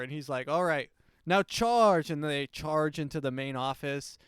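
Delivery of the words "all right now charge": louder, slow rallying command; battle cry. Retold or imitated. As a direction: order the troops forward. Leading the riot charge.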